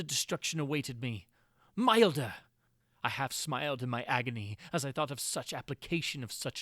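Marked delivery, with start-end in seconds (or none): 1.8-2.3 loud, higher, scornful repeat of the word Milder; bitter exclamation. A narrator's reading. Prisoner scoffs at his milder fate, loud bitter exclamation. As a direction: spit the word out in bitter irony.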